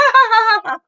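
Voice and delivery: high, loud mock cackle; put-on gleeful cackle; imitated cackle. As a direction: burst out with a prankster's high laugh. Imitated friend's laugh at prank reveal.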